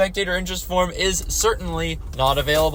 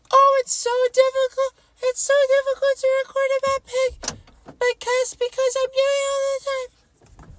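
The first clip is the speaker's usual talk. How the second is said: high, nasal whine; whining voice. Acted. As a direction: whine it like a sulky kid. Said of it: "I've been using my whining voice".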